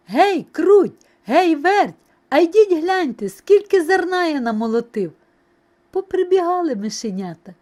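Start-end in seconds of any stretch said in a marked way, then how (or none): 0.1-5.1 loud, high hailing; calling out. A narrator's reading. Rooster summoning mice to see the grain, calling out. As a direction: call across the yard proudly. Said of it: "та й знов гукає".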